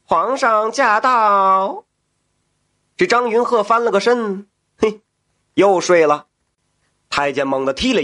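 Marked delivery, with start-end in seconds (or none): 0.0-1.8 high, slow drawn-out eunuch call; shrill palace servant. Acted. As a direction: stretch out the announcement, high. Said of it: "太监叫道".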